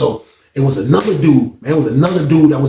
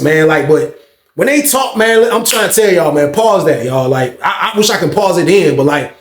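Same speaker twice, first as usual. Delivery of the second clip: ordinary delivery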